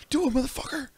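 high squeaky dare; high taunt. Pretend. Taunting back, pitch jump.

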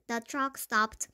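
ordinary delivery, nothing marked

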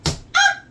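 sudden high yelp of alarm; startled yelp. Real, not acted. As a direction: shriek as the dart flies off. Dart goes wild, yelp.

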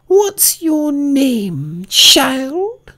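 very high, very slow imperious voice; Queen of Hearts interrogating Alice; severe Queen. Acted. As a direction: drag out the demand severely. Queen voice held.

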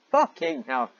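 high, loud exasperated curse; exasperated outburst. Real, not acted. Reader exploding at the fanfic, high and loud. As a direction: yelp it out in disbelief.

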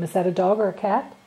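ordinary talk, nothing marked

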